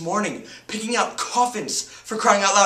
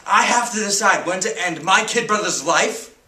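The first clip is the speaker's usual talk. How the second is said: high, loud, bitter protest; anguished outrage; 5 semitones higher, 6 dB louder than usual. Acted. Teenager told to decide brother's death, anguished outrage. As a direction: cry out against the unfairness. Outraged at life-support decision.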